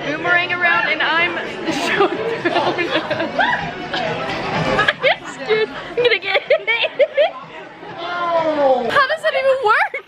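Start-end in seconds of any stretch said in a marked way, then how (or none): 1.4-4.8 breathy nervous giggling; nervous giggle. Real, not acted. Man throws a boomerang in the shop, nervous giggle. 4.9-7.3 higher, slow, through laughter; laughing scared. Real, not acted. Dodging a boomerang in the toy store, laughing scared. 7.3-10.0 laughing disbelief. Real, not acted. Watching a man throw a boomerang, laughing disbelief.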